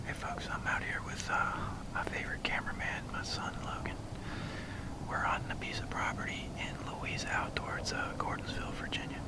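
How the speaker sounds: hushed hunting whisper. Real, not acted. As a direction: whisper so the deer won't hear. Hunter whispering in the blind.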